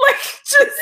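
high, loud laughter breaking the words; helpless laughter. Real, not acted. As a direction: laugh so hard the words barely come out. Cracking up mid-story.

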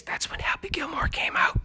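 hushed, voiceless whisper; quick whispered footnote; whispered aside. Real, not acted. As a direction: whisper it confidentially to the listeners.

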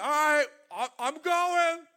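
light reluctant voice; half-hearted yes; voicing Abram. Lot tagging along, voiced.

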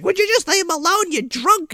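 high pleading mom voice. Retold or imitated. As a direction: mimic mom begging him to back off, pitched high. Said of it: "and she's like".

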